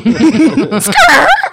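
high, loud burst of laughter. Real, not acted. Laughing at own joke to friends. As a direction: crack up at yourself.

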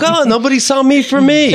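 high, loud whiny voice; mock whiny bully. Mocking the bullies' excuse in a high whine. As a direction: whine it in mockery. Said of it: "It's like".

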